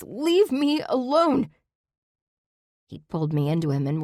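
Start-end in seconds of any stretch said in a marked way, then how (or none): 0.0-1.5 slow, much higher, louder furious shriek; shrieked curse. A narrator's reading. Shoving off Zach, shrieking at him to leave. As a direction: fling it at him as you shove off. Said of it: "with a shrieked curse".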